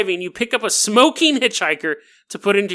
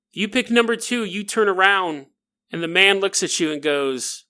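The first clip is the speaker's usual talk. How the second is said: ordinary talk, nothing marked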